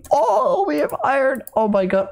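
high excited squeal. Real, not acted. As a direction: squeal with delight at the find. Finds iron excitedly.

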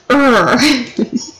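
short mock-angry growl. Player copies her game character, mock angry growl. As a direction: let out a playful imitation growl. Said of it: "Oh, the angry growl".

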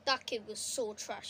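ordinary talk

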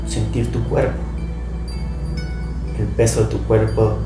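calm guided meditation voice; right in your ear. Acted. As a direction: guide them gently into stillness.

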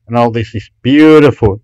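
ordinary talk, nothing marked

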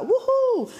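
high, loud mock-excited woohoo; playful retold whoop. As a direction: let out a cheeky high-pitched whoop, hammed up. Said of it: "I was a little bit like".

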